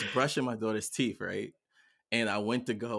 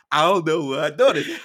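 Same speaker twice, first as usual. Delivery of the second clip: loud, high frantic self-quote; retold panic; 7 semitones higher, 14 dB louder than usual. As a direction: act out telling someone the child is lost. Retold panic over missing daughter.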